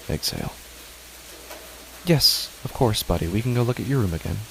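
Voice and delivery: usual speaking voice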